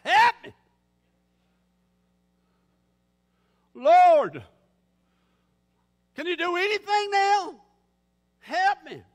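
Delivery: high, loud plea to God; acted desperate shouting for help; desperate holler. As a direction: cry out at full voice, desperately. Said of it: "He's just out of desperation".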